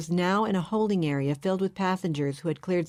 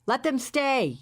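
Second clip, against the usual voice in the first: a higher, mock-yelled order; 8 semitones higher, slower than usual. A narrator's reading. Roger telling the policeman to let the car stay, mild yell. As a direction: call it out to the officer, only lightly raised. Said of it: "yelled Roger".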